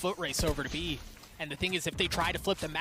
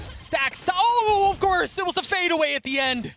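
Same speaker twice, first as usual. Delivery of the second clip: high, loud hype call with a cry; esports caster; 12 semitones higher, 10 dB louder than usual. Real, not acted. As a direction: ride the tension of the ace. Live play-by-play, ace attempt.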